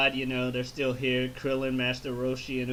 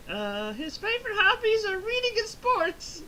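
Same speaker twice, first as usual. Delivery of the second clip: much higher falsetto wife voice; Chi-Chi. Retold or imitated. Wife answering the interviewer, high falsetto. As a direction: squeak it like a flustered housewife.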